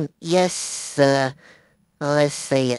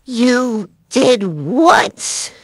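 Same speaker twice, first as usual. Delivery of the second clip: a loud, very slow retake; slow rising disbelief; 5 semitones higher, 5 dB louder, much slower than usual. Acted. Second take of the line.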